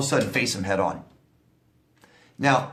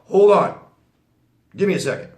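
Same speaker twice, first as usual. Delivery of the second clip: sharp, much louder and higher hold on; curt brush-off; 6 semitones higher, 8 dB louder than usual. Shown as a demonstration. Brushing off an annoying coworker mid-question, curt and loud. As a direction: cut them off like they don't matter. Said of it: "That's more important than what they're saying".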